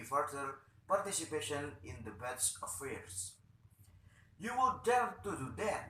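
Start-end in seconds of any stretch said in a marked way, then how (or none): 4.4-5.9 defiance. Acted. Acted defiant challenge.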